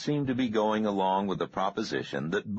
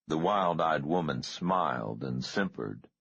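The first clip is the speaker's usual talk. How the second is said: usual speaking voice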